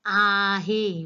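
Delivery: आहे drawn out very long; stressed आहे. Shown as a demonstration. Teacher pointing out the verb she stresses, आहे emphatically stretched. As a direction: hold आहे long and push it. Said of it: "ह्या शब्दावर मी जोर देत आहे".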